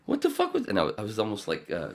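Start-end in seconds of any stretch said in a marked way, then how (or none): 0.0-0.7 high, startled exclaim; a man stunned by his own video; stunned reaction. Retold or imitated. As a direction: blurt it in shock. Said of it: "I'm like".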